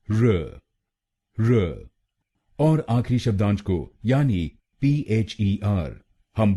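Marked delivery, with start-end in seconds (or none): 0.1-1.9 र said alone as a weak "ruh"; र reduced to a short "ruh"; syllable demo. Shown as a demonstration. Teacher isolating the syllable: र as a short "ruh". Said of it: "हम बोलेंगे".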